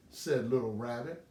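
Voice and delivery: ordinary delivery, nothing marked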